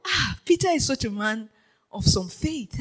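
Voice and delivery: ordinary talk, nothing marked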